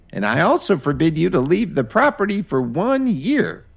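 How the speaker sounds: put-on very high, firm old voice laying down punishment; disappointed stern grandmother. Grandmother punishes grandson Jason, stern high old voice. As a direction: scold him and hand down the sentence.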